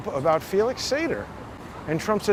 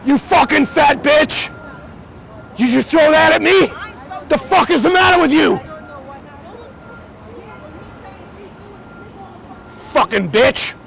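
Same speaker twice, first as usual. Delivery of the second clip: screaming much higher and louder in real rage; furious shout; 11 semitones higher, 7 dB louder than usual. Real, not acted. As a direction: yell him off the sidewalk at full force. Real street fight with cyclist.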